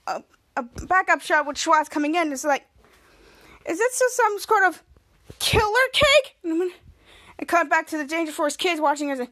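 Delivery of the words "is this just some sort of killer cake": mock-worried question. Host voices Schwoz wondering about the cake, lightly mock-worried. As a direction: ham up the suspicion a little.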